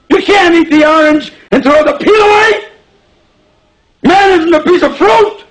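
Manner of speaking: high-pitched outraged shouting at the boss; full-voiced, furious outburst; yelling. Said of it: "I was yelling at him".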